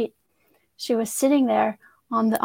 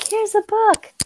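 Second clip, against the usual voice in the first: high, sing-song blissful offer; mock-blissful devotee handing over a book; 10 semitones higher, 3 dB louder, slower than usual. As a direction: imitate a devotee lost in bliss. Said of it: "they're in bliss".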